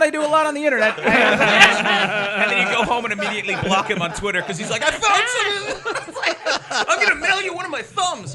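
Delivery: high, laughing voice. Real, not acted. Comic giggling while voicing fans, laughing. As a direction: giggle through the bit. Laughing through imagined fan lines.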